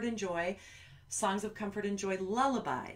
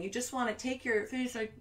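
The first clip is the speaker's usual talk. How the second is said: usual speaking voice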